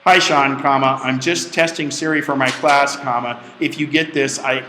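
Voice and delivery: punctuation spoken aloud, deliberate; dictation voice. Shown as a demonstration. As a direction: dictate the email to Siri, saying each comma. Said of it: "what would you like the email to say".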